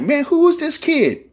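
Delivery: high, amazed mock exclaim; surprised. On first hearing Crip Mac, high and amazed. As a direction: sound stunned by the kid. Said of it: "I was like".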